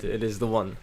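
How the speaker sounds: usual speaking voice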